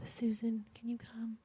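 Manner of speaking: quick whispered aside; hushed request to a helper. Real, not acted. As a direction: whisper it so the room stays hushed. Aside to Susan during prayer.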